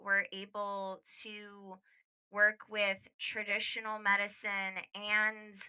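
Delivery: soft, sighing, careful explanation; sigh, then reason gently in a hushed voice; weary doctor. Acted. Doctor asking Yuri to be discreet, weary and soft.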